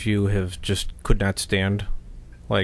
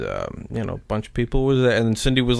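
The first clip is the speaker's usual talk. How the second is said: ordinary delivery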